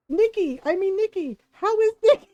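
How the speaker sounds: rising flustered rush on Nikki; flustered correction. Retold or imitated. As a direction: blurt the right name in embarrassed panic. Correcting a forgotten name.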